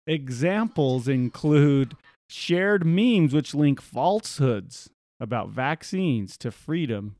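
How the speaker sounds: slow, deliberate stress on "shared memes" and "falsehoods"; pointed repeat. Real, not acted. Host repeating the article to make a point, slow emphatic read. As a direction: spell out "vaccines" and "freedom" slowly and pointedly. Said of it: "Let me just repeat that".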